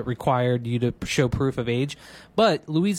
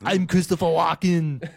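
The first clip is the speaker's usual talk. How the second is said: lightly voiced, deliberately bad celebrity impression; half-hearted go at a famous actor's voice; Christopher Walken; 2 semitones higher than usual. Reluctant guest goaded into a bad impression, halting celebrity voice. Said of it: "give us a bad Walken".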